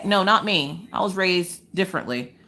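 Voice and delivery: usual speaking voice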